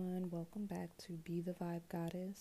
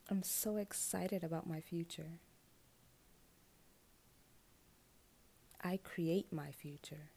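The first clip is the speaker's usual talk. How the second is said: affirmation reading; 3 dB louder than usual. Acted. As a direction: speak each affirmation like a vow. Reads affirmations slowly.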